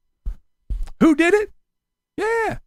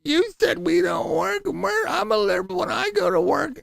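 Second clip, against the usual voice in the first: much higher, softer whiny mock voice; mocking the whiny commenters in a high voice; 11 semitones higher, 5 dB quieter than usual. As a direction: whine like a petulant critic. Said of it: "a bunch of whiny little bitches came over".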